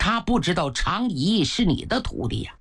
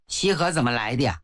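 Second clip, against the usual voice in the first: usual speaking voice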